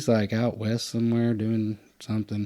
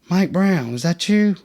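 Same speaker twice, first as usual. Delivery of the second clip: called out higher and louder in surprise; surprised recognition; 7 semitones higher, 4 dB louder than usual. Retold or imitated. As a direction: recognize an old friend, stunned. Recognizing old friend on death row.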